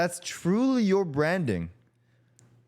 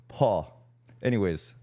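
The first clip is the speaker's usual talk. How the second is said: ordinary talk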